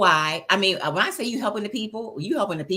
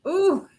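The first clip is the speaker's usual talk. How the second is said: delighted laugh; 12 semitones higher, 3 dB louder than usual. Real, not acted. Reacting to a powerful voice.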